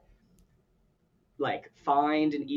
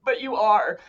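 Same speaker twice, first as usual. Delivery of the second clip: upset protest; 8 semitones higher, 8 dB louder than usual. Retold or imitated. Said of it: "And I'd be like".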